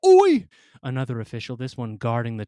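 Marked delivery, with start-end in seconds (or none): 0.1-0.5 loud, high British shout. Acted. Boarding agent shouting at the coughing boy, loud and high. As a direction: bark it up the ramp. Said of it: "shouted something in British".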